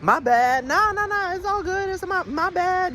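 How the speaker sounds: high, mock casual adult voice; imitating a chill adult excusing himself; adult saying my bad. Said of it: "You know when adults say".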